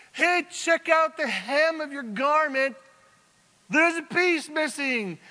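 high, loud long-distance holler; shouting from afar. Acted. David calls to Saul out of bow shot, shouting. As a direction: project every word across a valley. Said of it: "from some distance away".

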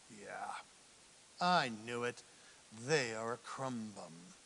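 hushed, half-whispered smug aside; suspicious mutter. Retold or imitated. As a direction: mutter it like a petty private suspicion. Suspicious person's inner thought.

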